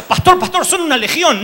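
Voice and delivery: high, loud comic confession; the neighbor playing along as the demon; mock possessed man. (vecino haciéndose el poseído, agudo y fuerte)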